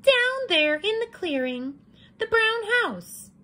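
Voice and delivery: put-on preen like a vain little girl. Red admiring her cape, high and sing-song. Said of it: "Little Red pointed".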